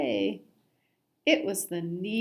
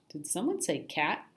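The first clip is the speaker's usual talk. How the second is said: plain reading voice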